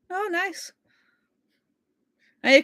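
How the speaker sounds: normal talking voice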